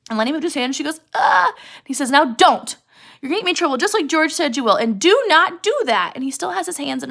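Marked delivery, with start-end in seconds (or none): 1.1-1.5 brief, high, loud cry; cut-short acted scream; short mock scream. Curly's wife once the hand lifts, short scream. 2.1-6.1 raised, urgent acted telling-off; agitated scolding. Lenny afraid of trouble with George, agitated scolding.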